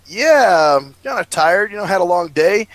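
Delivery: ordinary talk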